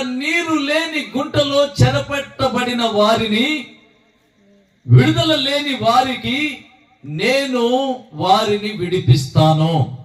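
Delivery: high, shouted, stretched-out preaching; preaching at full force. Acted. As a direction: lift the crowd with a slow, ringing call. Preacher's climactic exhortation. (ఎత్తైన గొంతుతో గట్టిగా అరుస్తూ బోధించడం)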